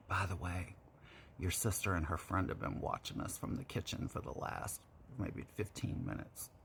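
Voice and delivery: hushed whisper in her ear; voiceless whispered secret. Retold or imitated. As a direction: breathe it right into the ear.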